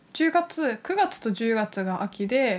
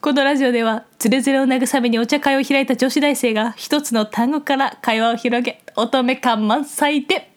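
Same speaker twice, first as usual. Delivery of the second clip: put-on raised, loud, elegant young-lady voice; haughty rich girl japanese; 3 semitones higher, 6 dB louder than usual. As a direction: greet guests like a refined heiress. Ojousama podcast greeting.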